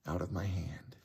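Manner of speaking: breathy near-whisper; barely voiced, moved. Real, not acted. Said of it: "I'm getting emotional".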